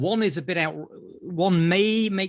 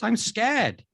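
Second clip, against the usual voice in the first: imitated scared child; 5 semitones higher than usual. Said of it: "He goes".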